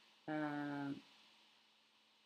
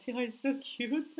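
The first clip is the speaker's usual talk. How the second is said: giggling through the words, pitched up; laughing; 9 semitones higher, 4 dB louder than usual. Real, not acted. Laughing while reading a fan's sweet message aloud. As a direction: laugh as you read the sweet comment.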